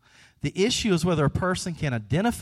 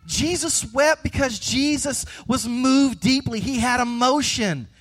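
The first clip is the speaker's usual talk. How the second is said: fervent preaching; 8 semitones higher than usual. Acted. Preacher driving home Jesus' emotion, high and impassioned. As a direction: lift it up and preach it over the music.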